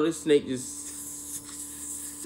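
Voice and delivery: a slow, unvoiced hiss like air leaking; imitating a tire going flat; hissing imitation.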